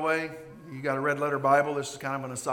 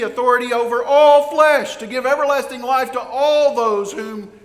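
higher and louder, driving preacher's climax; preaching at full force; 6 semitones higher, 8 dB louder than usual. Acted. Preacher proclaiming Christ's authority to the congregation, at full force. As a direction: build the sermon to its peak.

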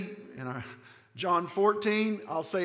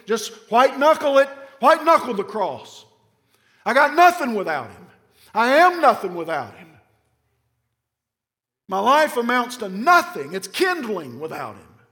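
loud, higher, driving each phrase home; fervent preaching; 4 semitones higher, 8 dB louder than usual. Real, not acted. Preacher exhorting the congregation to cling to Jesus, loud and higher. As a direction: preach it out full force, pounding "nothing".

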